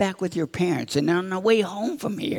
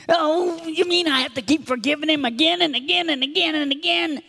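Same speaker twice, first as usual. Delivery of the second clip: high, quick mock protest; exasperated child; 8 semitones higher than usual. Child told to keep forgiving his brother, exasperated protest. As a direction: rattle off the repeats in a high, incredulous voice.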